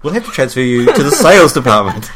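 hammed-up call-centre brush-off; imitating a polite agent passing you on; mock agent. Retold agent transferring again.

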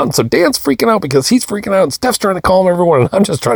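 fast, high, escalating run-on; frantic rant. Retold or imitated. Dad recounts everyone freaking out as he showers, frantic. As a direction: pile up the chaos in one harried rush. Retelling escalating family chaos.

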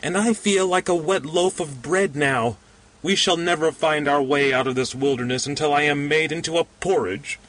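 high, moaning lament. A narrator's reading. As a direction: whine it out in misery. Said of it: "said the pudgy man moaningly".

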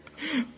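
short high giggle. Real, not acted. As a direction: giggle at your own slip. Laughs at own slip of words.

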